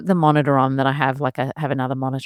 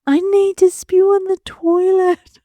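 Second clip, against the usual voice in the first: put-on high, slow little child voice; toddler voice; 5 dB louder, slower than usual. Mum imitating her toddler asking for the toilet, high slow child voice. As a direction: play a tiny kid proudly announcing it. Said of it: "what do I hear".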